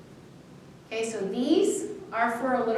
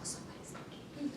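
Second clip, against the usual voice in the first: whisper; 3 semitones higher, 7 dB quieter than usual. Retold or imitated. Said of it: "I didn't feel the".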